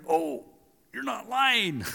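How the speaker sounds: high-pitched dawning surprise; retold astonishment. Hunter realizing his friend told the truth, astonished. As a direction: act out a sudden realization.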